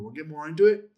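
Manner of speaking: ordinary talk, nothing marked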